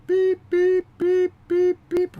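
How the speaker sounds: very high, loud alarm beeps; loud imitated beeping. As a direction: blare it like a night alarm.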